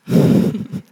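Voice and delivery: a quick embarrassed laugh; short laugh. Real, not acted. Preacher laughs after forgetting the slide, short laugh.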